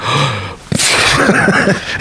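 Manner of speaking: mock gasp of shock; drawn-out, acted gasp. Screw hearing of the security breach, imitated shocked gasp.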